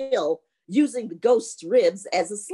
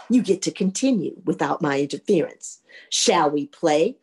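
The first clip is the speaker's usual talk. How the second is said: boastful menacing ghost; 6 semitones lower than usual. Acted. Ghost number two challenges the young man, low boastful menace.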